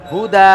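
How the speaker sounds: হুদা loud, last vowel held open without n; pausal form. Shown as a demonstration. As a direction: stretch the end of হুদা as you stop. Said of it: "তাই বলতে হবে".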